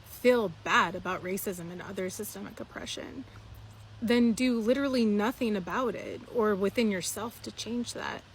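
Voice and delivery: normal talking voice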